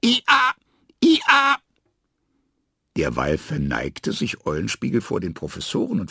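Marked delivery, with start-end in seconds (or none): 0.0-1.6 high, loud donkey cries; loud bray. A narrator's reading. The hungry donkey brays at the professors, searching for oats. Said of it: "schrie immerfort".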